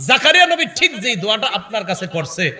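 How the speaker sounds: impassioned model supplication; tearful plea, acted. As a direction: press the plea urgently to God. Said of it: "চোখের পানি ছেড়ে এই কথাটা বলেন".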